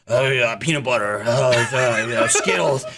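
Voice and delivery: a low, spaced-out mumble; a hint of a dazed man; lightly dazed rambling. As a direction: mumble like you're possessed and lost. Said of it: "he's like".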